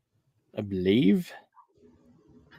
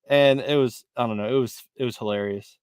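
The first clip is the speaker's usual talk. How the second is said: ordinary talk, nothing marked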